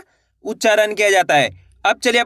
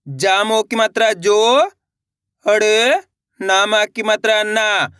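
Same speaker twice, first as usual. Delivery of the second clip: slow, each syllable set apart; patient slow phonics reading; slow spelling-out; slower than usual. Shown as a demonstration. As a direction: sound it out for a child. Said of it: "मेरे साथ साथ इनको repeat करते आना है".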